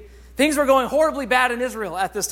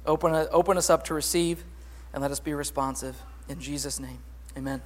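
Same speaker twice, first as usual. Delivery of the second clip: soft, lowered prayer voice, trailing off quieter; opening prayer; 5 semitones lower, 4 dB quieter than usual. Acted. Pastor praying before the sermon, hushed and earnest. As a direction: pray over the congregation gently.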